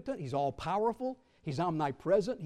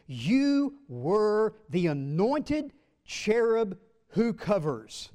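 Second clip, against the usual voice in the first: very slow, loud, stretched reading; solemn proclamation; 6 semitones higher, 8 dB louder, much slower than usual. Acted. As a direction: intone it slowly like a verdict.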